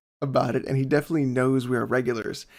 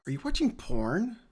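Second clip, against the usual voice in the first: higher, questioning imitation; retold suspicious wife; 7 semitones higher, 4 dB quieter than usual. Wife catching husband at his screen, higher retold question. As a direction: lift the pitch into an accusing question. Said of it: "all of a sudden she's like".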